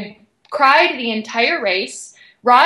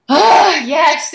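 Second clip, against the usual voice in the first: relieved season end; 5 semitones higher, 5 dB louder than usual. Retold or imitated. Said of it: "we're like".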